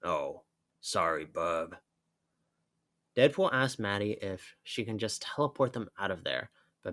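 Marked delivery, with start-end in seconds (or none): 0.0-1.8 dry Wolverine. Acted. Wolverine answers Deadpool dryly.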